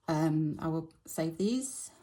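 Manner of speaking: ordinary talk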